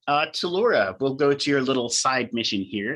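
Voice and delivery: ordinary talk, nothing marked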